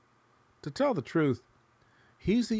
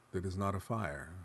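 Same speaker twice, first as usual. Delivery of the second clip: ordinary delivery, nothing marked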